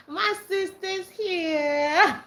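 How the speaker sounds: overjoyed and breaking into laughter; squealing it with tearful joy; excited laughing. Real, not acted. Repeating announcement, nearly crying laughing.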